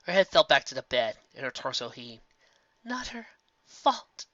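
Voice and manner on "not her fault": 1 faint gasp. A narrator's reading. Dying Celestia gasps.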